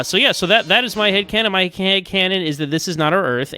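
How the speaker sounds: normal talking voice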